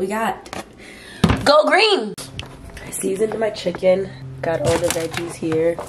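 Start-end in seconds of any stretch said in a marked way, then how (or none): 1.4-2.1 excited. Real, not acted. Cheerful vlog opener shout.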